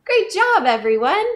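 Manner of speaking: high and loud cheer for the class; excited praise. Real, not acted. Praising children after the song.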